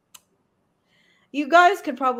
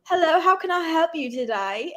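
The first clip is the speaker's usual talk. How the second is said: higher-pitched mock English accent; so-called English accent; 4 semitones higher than usual. Cashier greeting a customer, so-called English accent. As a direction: put on a badly faked English accent. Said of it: "my so-called English accent".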